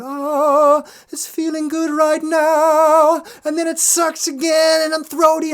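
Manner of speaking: high, loud, slow mock delight turning sour; acted singer's mood swing. A singer narrating her own practice swings, high and loud. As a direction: squeal the good moment then sour on it. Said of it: "being in the moment and going".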